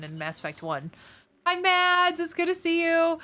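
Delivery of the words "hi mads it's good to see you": cheerful greeting. Real, not acted. Greeting a viewer in chat.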